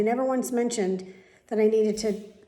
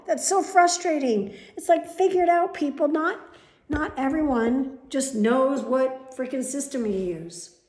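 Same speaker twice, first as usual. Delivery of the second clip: loud, higher, heated rant; real anger; 4 semitones higher, 6 dB louder than usual. Real, not acted. Ranting at the clinic staff, loud and angry. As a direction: vent at them, fired up. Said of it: "I'm so angry".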